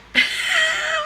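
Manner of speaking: high-pitched burst of real laughter; amused laugh. Real, not acted. Vlogger admitting she'd buy ugly sandals, high amused laugh. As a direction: crack up at your own admission.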